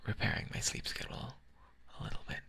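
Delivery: breathy, near-whispered relaxed talk; sleepy, whispered ASMR-style chat; hushed whispering. Real, not acted. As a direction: murmur it close, with long pauses. Quiet, rambling whispered talk.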